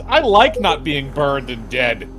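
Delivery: normal talking voice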